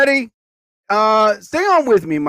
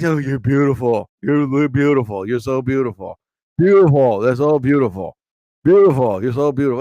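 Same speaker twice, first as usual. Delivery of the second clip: mocking pushy guys; 5 semitones lower than usual. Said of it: "they always are like".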